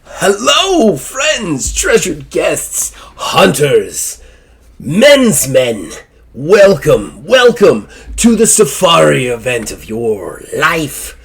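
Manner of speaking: high, slow, oily showman welcome; showman host. Acted. Host Zazelworth welcomes rich hunters, high slow showman voice. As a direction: roll out the welcome like a ringmaster. Zazelworth greets his hunting guests.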